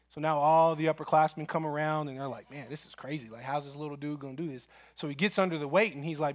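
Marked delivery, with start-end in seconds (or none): 2.5-4.7 much softer, fast aside; hushed disbelief among the older guys; murmuring teens. Retold or imitated. Upperclassmen murmuring among themselves.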